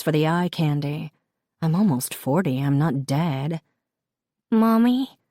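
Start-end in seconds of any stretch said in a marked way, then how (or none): 4.5-5.2 put-on small, higher child's voice; Charlotte's little-girl voice. A narrator's reading. Charlotte calls her mother from the back seat, little-girl voice. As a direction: call out to mom like a little girl. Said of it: "comes Charlotte's voice".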